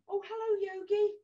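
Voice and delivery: ordinary talk, nothing marked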